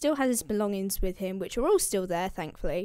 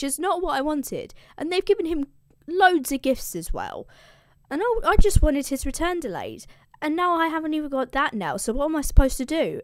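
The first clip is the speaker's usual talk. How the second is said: higher, aggrieved god complaining; mock indignation; 6 semitones higher, 5 dB louder than usual. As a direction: whine to Zeus in mild mock outrage. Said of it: "Poseidon is mad".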